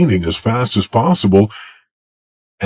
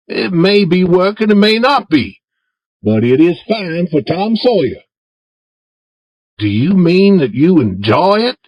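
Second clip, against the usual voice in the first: put-on higher, boyish voice for Tom; Tom asking Jim; 10 semitones higher than usual. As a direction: coax Jim in a raised boy's voice. Tom pretending to enjoy.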